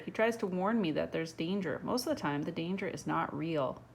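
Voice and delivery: ordinary delivery, nothing marked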